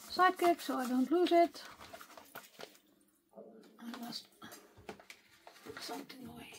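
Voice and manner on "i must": ordinary talk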